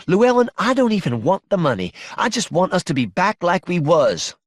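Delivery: high-pitched, imploring wife; distressed pleading. Acted. Wife begging husband to give up the money, distressed. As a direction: plead with him, voice lifted.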